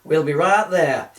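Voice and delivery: loud, slow, mock-helpful call. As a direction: shout it back across the water. Camera crew calling back.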